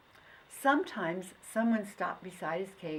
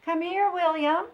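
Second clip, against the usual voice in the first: high, loud call across the room; mother calling; 11 semitones higher, 9 dB louder than usual. Acted. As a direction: call your boy over. Mother calling her son.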